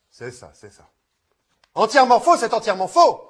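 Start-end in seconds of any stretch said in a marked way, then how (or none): 1.7-3.2 shouted flat contradiction; loud denial. Acted. Contradicting the other player angrily, loud and firm. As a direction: slam the claim down hard.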